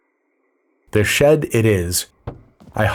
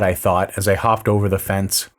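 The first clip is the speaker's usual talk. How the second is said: ordinary delivery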